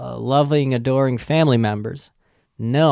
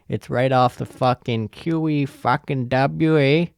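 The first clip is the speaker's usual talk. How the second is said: hammed-up Canadian accent with a tagged "eh"; horrible Canadian accent. Podcaster jokingly placing the buffet by the highway, horrible Canadian accent. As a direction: do a bad mock Canadian accent. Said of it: "That's a horrible Canadian accent".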